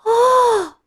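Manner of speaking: high, drawn-out "oh" of amazement; long amazed cry. A narrator's reading. Puppet recognizes the woman before him, long amazed cry. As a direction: hold one high astonished note. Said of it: "他就惊奇地拖长声音大叫".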